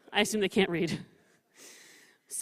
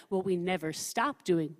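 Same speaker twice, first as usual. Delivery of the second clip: ordinary talk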